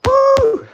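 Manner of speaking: high, loud "woo"; sustained high-pitched holler; excited whoop. Real, not acted. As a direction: let out a big hyped whoop. Hyped whoop while training.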